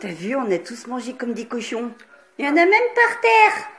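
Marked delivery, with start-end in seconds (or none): 2.4-3.7 high, loud exclamation; surprised exclaim. Real, not acted. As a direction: exclaim it in shock. Mess at family breakfast.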